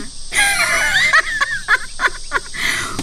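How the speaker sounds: high burst of mocking laughter. Mocking laugh at rival's question.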